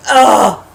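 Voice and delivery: loud exasperated "ugh"; frustrated groan. Retold or imitated. Viewer fed up with the heroine, frustrated groan.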